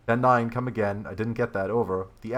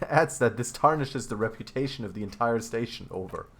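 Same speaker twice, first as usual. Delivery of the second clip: normal talking voice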